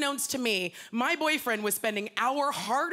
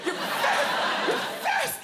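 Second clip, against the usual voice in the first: much higher, squealing "You're fierce!" repeated; squealed fierce; much slower than usual. Retold or imitated. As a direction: gush at her over and over. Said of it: "They're like".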